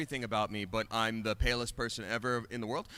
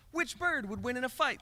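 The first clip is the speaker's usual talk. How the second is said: high-pitched, playful example question; put-on high questioning voice; mock sample question; 10 semitones higher than usual. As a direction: pose it high, as a silly question. Acting out a sample random question.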